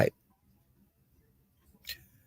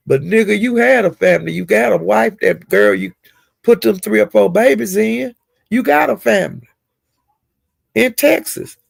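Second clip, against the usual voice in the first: high-pitched, incredulous talking back; exasperated, rising-pitched scolding; indignant protest; 7 semitones higher than usual. Real, not acted. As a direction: argue back at him, pitch climbing as you go. Indignantly arguing back at the man.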